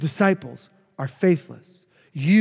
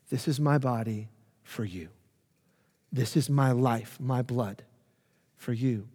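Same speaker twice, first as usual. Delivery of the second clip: much softer and lower, a tender offering; tender hush; 6 semitones lower, 8 dB quieter than usual. Acted. Jesus speaking tenderly to Judas, who will betray him, softly. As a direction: say it gently, almost in a hush, as a gift.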